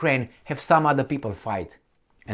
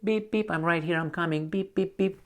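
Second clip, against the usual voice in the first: mock electronic beeping, a bit higher and soft; transponder beeps; 5 semitones higher, 5 dB quieter than usual. Mocking the drone's missing signal, beeping softly. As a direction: chirp the beeps like a little signal.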